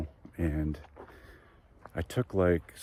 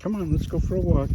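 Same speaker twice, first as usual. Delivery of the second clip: squeaky praising a dog; 9 semitones higher, faster than usual. Acted. Calling the dog.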